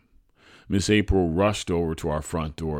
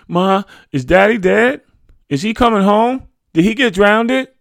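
put-on high, loud boy's pleading questions; anxious child; 12 dB louder than usual. A narrator's reading. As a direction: press mother with urgent questions, pitched high. Frightened boy questions mother.

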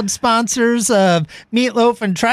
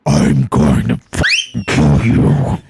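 menacing low voice; 3 dB louder, slower than usual. Acted. ASMR demo turned menacing.

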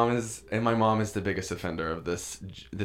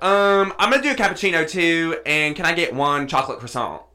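louder, higher breezy order of a cappuccino and croissant; a loud, unbothered tourist sister; sister's careless order; 8 semitones higher, 7 dB louder than usual. Retold or imitated. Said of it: "She's, like, talking like this".

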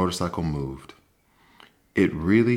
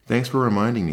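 bright thanks; 5 semitones higher, 5 dB louder, faster than usual. A narrator's reading. Mouse answers the boy.

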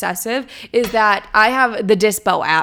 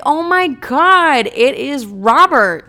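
high, slow, loud fake delight; mock gushing; 5 semitones higher, 6 dB louder, much slower than usual. Texting an old match, imagining wrongly guessing it's Robert, slow high mock delight. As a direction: drag out the thrilled gasp like you've spotted an old flame.

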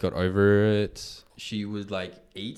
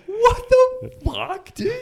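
much higher, loud and slow; a squealed what the fuck; shocked disbelief; 8 dB louder, much slower than usual. Retold or imitated. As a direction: stretch it out in total disbelief. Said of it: "And it's like".

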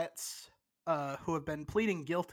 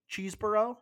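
unsure name; 5 semitones higher, 4 dB louder than usual. Real, not acted. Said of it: "I think it's Chesebro".

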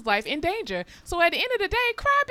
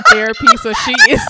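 high, loud laughing talk; words breaking up in laughter; 12 dB louder than usual. Real, not acted. Host laughing at her co-host for checking out.

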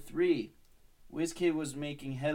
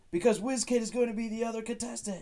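much higher, louder ominous reveal; dramatic reveal; 11 semitones higher, 5 dB louder than usual. Acted. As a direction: land the shocking news. Mike reveals the twist.

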